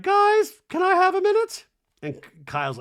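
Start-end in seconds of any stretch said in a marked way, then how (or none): 0.0-1.7 very high, loud put-on voice; hammed-up imitation of a housewife asking; Dorit. Dorit asking for privacy, mock pleading. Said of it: "And Dorit's like".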